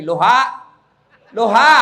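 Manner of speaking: LOHA, halo with syllables swapped, called loudly; comic phone greeting said backwards as "Loha"; reversed hello. Retold or imitated. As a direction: shout "Loha" with a rising end. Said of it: "Dia bilang lagi".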